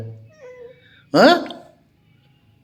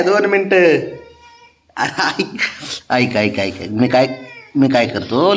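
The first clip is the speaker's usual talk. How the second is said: higher, sing-song coaxing of a sulking pet; 5 semitones higher, 8 dB louder than usual. Real, not acted. Owner coaxing his sulking cat Babya before work, sing-song coaxing. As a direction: sweet-talk the cat so it lets you leave.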